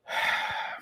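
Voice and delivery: soft, airy exhale; sigh. Real, not acted. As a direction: let out a quiet sigh before going on. Reluctantly turns to a touchy topic.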